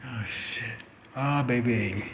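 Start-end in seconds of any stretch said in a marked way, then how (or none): 0.1-0.8 under-the-breath dread. Retold or imitated. Caught, dread.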